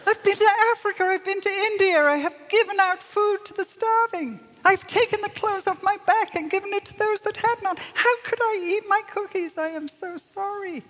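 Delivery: high, loud, distraught pleading to the Lord; anguished remorse. Retold or imitated. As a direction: cry out in guilty despair. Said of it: "the guilt and the shame".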